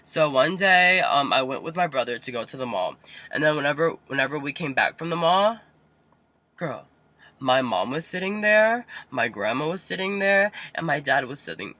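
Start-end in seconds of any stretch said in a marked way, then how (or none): none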